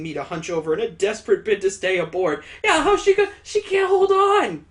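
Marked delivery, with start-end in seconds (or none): 2.6-4.6 loud, high-pitched disbelief; incredulous outburst. Real, not acted. Reader objecting that she can't hold on, incredulous. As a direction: protest loudly at a much higher pitch. Reader reacts to absurd piggyback ride.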